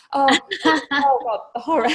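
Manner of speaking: loud, laughing groan at the memory; laughing through words. Real, not acted. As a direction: laugh out the horror.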